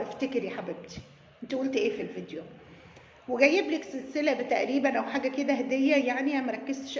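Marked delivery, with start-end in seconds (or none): 0.0-2.4 sarcastic aside. Acted. Sarcastic aside, much quieter.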